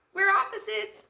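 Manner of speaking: put-on high, loud girlish chorus; two girls in unison. Cookie and Milk answering together, gleeful. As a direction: shout it together like best friends.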